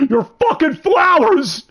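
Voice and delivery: high, drawn-out shout; mock yell. Reacting to their love of bluebells, mock yelling. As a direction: blow up over it, loud and slow. Mock outburst about the flowers.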